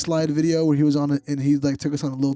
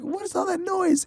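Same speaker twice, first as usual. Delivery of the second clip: high squeaky question. Retold or imitated. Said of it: "It's like".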